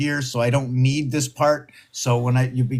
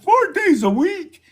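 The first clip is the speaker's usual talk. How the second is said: much higher, amazed disbelief; surprised; 3 dB louder than usual. Real, not acted. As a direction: say it like you can't believe it. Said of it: "Oh my God".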